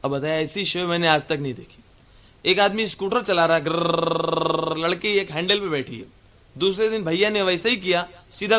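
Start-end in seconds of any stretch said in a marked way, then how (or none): 3.6-4.9 scooter engine rumble. Retold or imitated.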